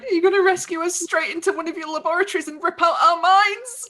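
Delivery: high, rising panicky question; alarmed villager. Acted. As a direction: challenge the rescuer in alarm. Frightened villagers challenge their rescuer.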